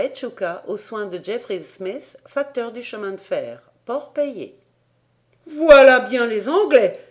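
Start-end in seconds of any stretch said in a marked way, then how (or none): 5.5-7.0 loud and high, scandalized outcry; indignant exclamation. Acted. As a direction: cry it out, outraged at the English. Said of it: "s'écria Paganel". (exclamation indignée, forte et aiguë)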